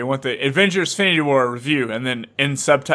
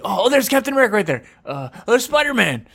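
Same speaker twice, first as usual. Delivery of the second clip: excited spotting; 7 semitones higher than usual. Pretend. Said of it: "You'd be like".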